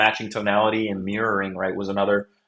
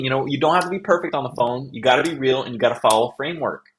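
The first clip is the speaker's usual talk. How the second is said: usual speaking voice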